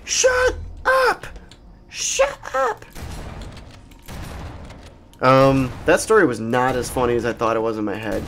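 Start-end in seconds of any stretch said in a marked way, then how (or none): 0.0-2.9 very high falsetto imitation of a screaming girl; hammed-up, thin high mock shriek. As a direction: screech it in falsetto without real volume. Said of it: "she just starts screaming at me".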